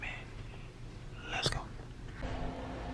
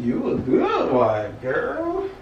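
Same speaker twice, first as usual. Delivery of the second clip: teasing sing-song; much slower than usual. Pretend. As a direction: tease her in a singsong. Teasing his wife with a chant.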